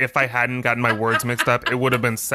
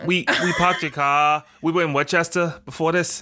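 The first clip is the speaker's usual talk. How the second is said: a mock Boston cop, mildly put on, a bit higher; Boston accent; 5 semitones higher than usual. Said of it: "you sound like a combination between Mayor Quimby".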